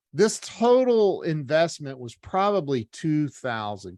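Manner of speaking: normal talking voice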